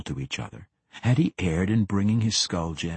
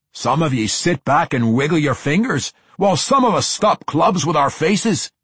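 loud, high dwarf complaint; gruff dwarf; 6 semitones higher, 5 dB louder than usual. A narrator's reading. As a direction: bellow it like an irked dwarf. Dwarf bickering with wizard.

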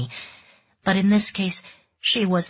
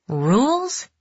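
surprised question; 8 semitones higher, 3 dB louder than usual. A narrator's reading. Mariko's inner questioning.

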